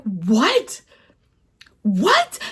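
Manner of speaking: incredulous what. Retold or imitated. Reviewer reenacting her shock at the book. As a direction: squeak out the disbelief.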